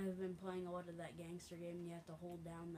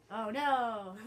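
loud, raised-pitch cry; dismayed outburst; 4 semitones higher, 14 dB louder than usual. Real, not acted. Player losing the last one, dismayed outburst. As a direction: wail it as the last one is lost.

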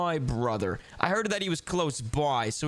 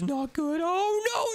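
panicky squeak; 12 semitones higher, slower than usual. Acted.

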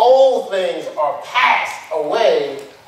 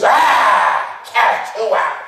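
mocking demon; 4 semitones higher, 3 dB louder, much slower than usual. Demons mock the disciples' exorcism.

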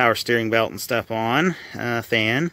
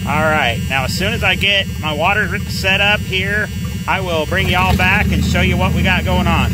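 louder and higher over engine noise; raised voice; 7 semitones higher, 5 dB louder than usual. Real, not acted. Mechanic promises viewers an update, raised voice over the engine. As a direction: project over a running motor.

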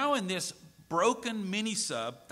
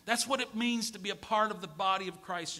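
usual speaking voice

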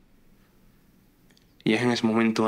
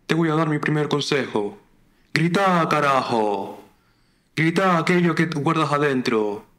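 raised, emphatic Argentine guru voice building to a call; Argentine psychoanalyst; 5 semitones higher, 3 dB louder, slower than usual. Acted. Online psychoanalyst giving his first advice, rousing Argentine voice. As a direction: urge it out like an Argentine motivator. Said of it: "A un psicoanalista argentino casposo".

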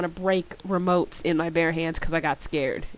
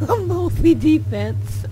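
laughing; 9 semitones higher, 4 dB louder, much slower than usual. Real, not acted. Joking about character.

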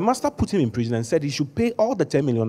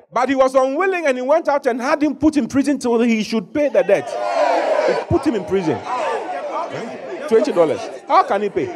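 high, rising, emphatic sermon delivery; fervent preaching; 10 semitones higher than usual. Acted. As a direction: preach it out to the whole church. Preaching full force.

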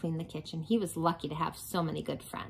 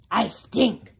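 garbage truck voice; 3 semitones higher, 7 dB louder than usual. Acted. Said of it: "garbage truck voice".

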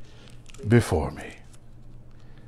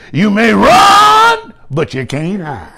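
Elder Brentley preaching; 3 semitones higher, slower than usual. Retold or imitated. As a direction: bellow it like a big old preacher. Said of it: "Elder Brentley said".

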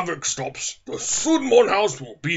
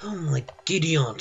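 ordinary talk, nothing marked

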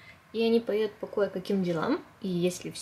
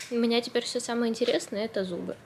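normal talking voice

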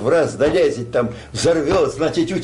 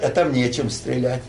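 normal talking voice

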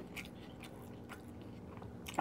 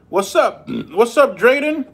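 excited greeting; 8 semitones higher, 5 dB louder, slower than usual. Real, not acted. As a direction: call out brightly to the little one. Greeting a kid on stream.

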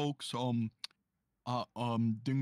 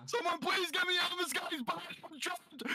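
very high, panicky put-on plea; Gagey Big C impression. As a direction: beg for rescue in a squealing character voice. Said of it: "what if he, uh, did the voice".

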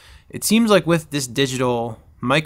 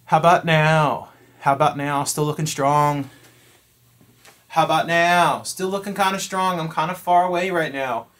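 raised-voice distance check; calling from afar; 4 semitones higher, 5 dB louder than usual. Real, not acted. Stepping back to test the mic, calling out. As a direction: project to a mic across the room.

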